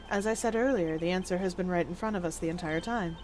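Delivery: low, soft, deliberate character voice; hushed, measured deduction; cool, composed investigator. Acted. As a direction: reason it out quietly and formally.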